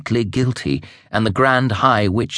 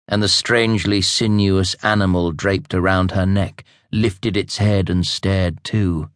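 plain reading voice

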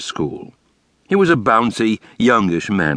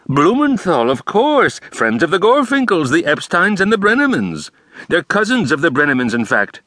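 higher, fussy theatrical principal; Brooks's voice; 11 semitones higher, 3 dB louder than usual. A narrator's reading. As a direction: lay it out with flair. Brooks rattling off families.

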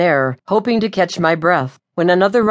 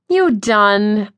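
whined; 4 semitones higher than usual. A narrator's reading. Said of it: "I whined".

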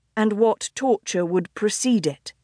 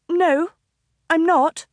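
higher, quick denial; Iris protesting; 8 semitones higher, 3 dB louder than usual. Acted. Iris objects to the crowd, higher and firm. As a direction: cut in to correct them, pitched up. Iris denies being the Duchess.

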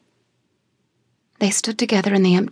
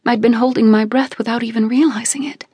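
ordinary delivery, nothing marked

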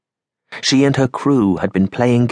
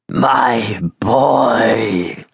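hoarse, whispered cry of grief; agonised rasp. A narrator's reading. Bereaved husband on the beach thinking of his son, agonised rasp. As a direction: rasp it out in agony. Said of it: "he rasped in agony".

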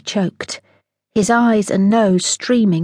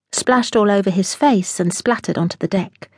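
plain reading voice

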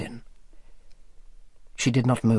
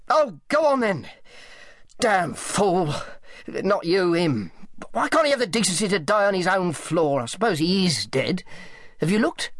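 higher, grumbling boss voice; irritable, callous Selby; 7 semitones higher than usual. A narrator's reading. Selby curses Higgs for dying there, irritable. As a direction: grumble at the inconvenience of it all.

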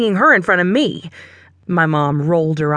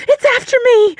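very high, loud terrified shriek; 5 dB louder, faster than usual. A narrator's reading. Cassie shrieks in terror as the dog topples on her. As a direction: scream as the dog falls on you. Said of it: "she shrieked".

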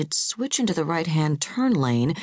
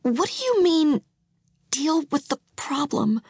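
alarmed; 11 semitones higher than usual. A narrator's reading. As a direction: recoil in horror as you ask. Wife alarmed by husband.

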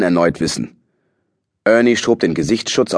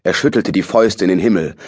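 usual speaking voice

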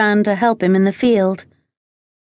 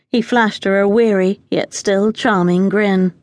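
plain reading voice

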